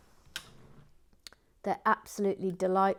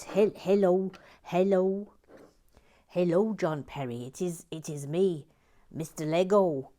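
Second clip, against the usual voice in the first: a mock-solemn louder caller voice; holy voice of Lego; 6 dB louder than usual. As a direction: play the grand voice of Lego calling. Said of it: "The holy voice of Lego came".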